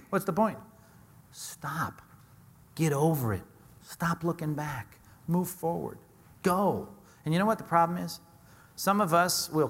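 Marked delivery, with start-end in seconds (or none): none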